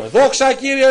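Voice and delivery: high, loud glory shout; praising God in loud joy; joyful praise. Retold or imitated. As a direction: cry out praise to the Lord.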